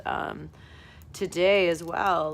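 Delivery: ordinary delivery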